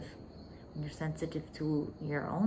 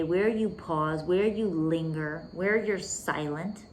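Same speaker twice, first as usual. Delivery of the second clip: slow, lingering delivery; 5 dB louder, slower than usual. Shown as a demonstration. Coach showing students how to pause, slow and lingering. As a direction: draw each phrase out slowly. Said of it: "tone of voice you use your words".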